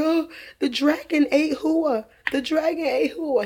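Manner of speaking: high-pitched mock alarm of a little boy; imitating scared child. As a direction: act out your son waking scared from a nightmare. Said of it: "he was very scared when he woke up".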